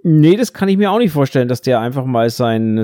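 usual speaking voice

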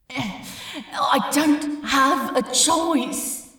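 slow, loud, strained resolve; anguished. A narrator's reading. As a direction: force the words out in anguish, slowly.

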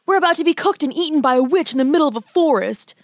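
put-on high, agitated boy; panicked protest. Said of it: "Hansel hissed".

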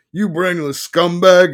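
slow, high, loud insult; shouted. A narrator's reading. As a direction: bellow it at your client. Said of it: "he shouted".

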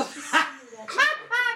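loud, high laugh; burst of laughter. Real, not acted. Host bursts out at co-host's teasing.

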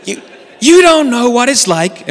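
hammed-up agent cracking under pressure; mock meltdown; losing it. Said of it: "he starts losing it".

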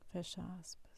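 soft closing cue; dictating. Acted. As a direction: let it drop quietly. Dictating quote marks.

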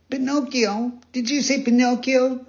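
high, amazed questioning; startled puppet boy. Acted. Pinocchio hearing the pigeon say his name, high and startled.

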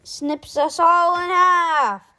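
high, loud, slow yell. Acted. Rip yelling a warning about the crab, loud. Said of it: "yelled Rip".

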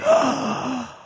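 loud high shocked gasp; dramatic gasp. Acted. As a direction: gasp in horror, hammed up. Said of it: "That was a great gasp".

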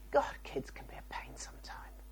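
soft whisper to herself; tired mother whispering her thought. Retold or imitated. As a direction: breathe it out as a private grumble.

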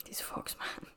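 a mock-secretive whisper; playful whisper. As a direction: hush it like a little secret. Repeats the name, then whispers it.

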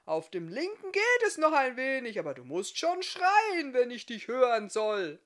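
half-deaf smith explaining his bad ears, shouting. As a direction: keep yelling like a man who can't hear himself.

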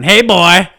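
put-on loud high toddler yell; shouting. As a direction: shout it right in their face. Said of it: "she was walking around going".

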